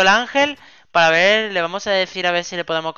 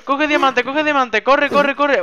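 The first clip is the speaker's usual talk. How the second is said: urgent urging; 8 semitones higher, 5 dB louder, slower than usual. Pretend. Urges partner to grab loot.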